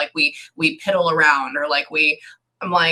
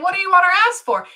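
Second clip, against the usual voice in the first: quick high question; 13 semitones higher, faster than usual. Retold or imitated. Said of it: "I said".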